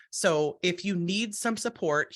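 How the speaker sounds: ordinary talk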